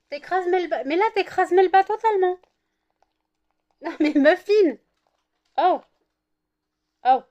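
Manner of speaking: loud, high telling-off; scolding dog. Real, not acted. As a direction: shoo the dog off loudly.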